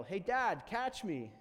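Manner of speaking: put-on high, loud kid's call to Dad; yell. Son on the cliffs to his dad below, excited yell. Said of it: "from above me yell".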